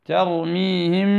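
ترميهم slow, meem hidden with nasal ghunna before بحجارة; ikhfaa demo. Shown as a demonstration. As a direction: drag ترميهم with two-count ghunna. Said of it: "الغنة موجودة". (ترميهم بغنة حركتين)

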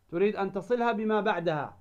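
ordinary talk

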